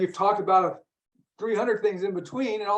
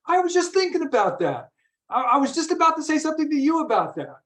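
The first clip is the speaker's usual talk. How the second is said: surprised, higher-pitched retelling; surprised exclamation; 10 semitones higher than usual. Retold or imitated. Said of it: "you said it".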